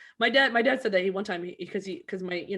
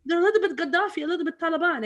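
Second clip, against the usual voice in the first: teasing sing-song; 8 semitones higher than usual. Retold or imitated. Mocking dad's joke.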